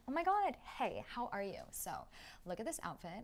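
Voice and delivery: a hint of valley-girl gush; mock sorority girl. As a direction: lightly parody a chirpy sorority girl. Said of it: "It was just very like".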